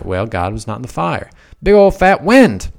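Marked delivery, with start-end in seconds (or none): 1.6-2.7 big, high, loud exclamation; booming storm wind. Acted. Preacher building up Elijah's mountain storm, loud and high. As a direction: punch it out like a huge gust.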